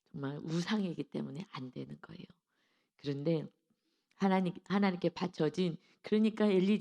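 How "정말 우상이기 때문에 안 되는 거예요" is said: soft, hushed whisper; whispered emphasis. Real, not acted. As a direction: lean in and whisper the point. Preacher whispers key point.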